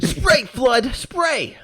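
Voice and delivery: high, loud hammed-up order; mock frantic command. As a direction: yell it as an urgent mock command. Joins in the mock yelling bit.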